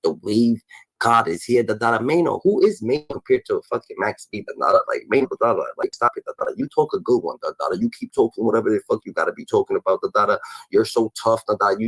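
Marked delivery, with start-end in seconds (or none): none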